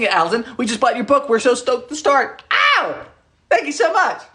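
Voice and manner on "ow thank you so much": excited squeal. Real, not acted. Excited by viewer message.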